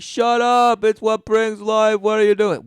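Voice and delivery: high, loud mock snap; mock angry retort. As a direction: ham up an indignant shout. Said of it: "and you're like".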